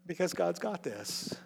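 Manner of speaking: very soft, slow and low-key; hushed slow reassurance; reassuring. Real, not acted. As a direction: settle the room with calm. Reassuring after mock wail.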